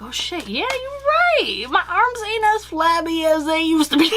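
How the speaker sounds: acted self-discovery rising into high laughter; imagined happy realization. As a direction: voice the dawning delight, cracking up by the end. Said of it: "you're gonna start to see".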